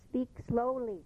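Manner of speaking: usual speaking voice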